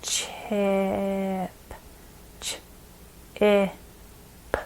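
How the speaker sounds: "Chip" split into "ch", "I", "p", slow with pauses between sounds; sounding out chip. Shown as a demonstration. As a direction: segment the word sound by sound. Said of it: "separate out".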